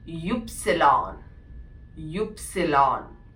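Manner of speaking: യുപ്സിലോൺ said slowly, "ewp-si-lon"; slow careful യുപ്സിലോൺ for the German Y; German letter Y. Shown as a demonstration. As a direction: say യുപ്സിലോൺ like German "Ypsilon", syllable by syllable. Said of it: "വൈയെ നമ്മൾ pronounce ചെയ്യുന്നത്".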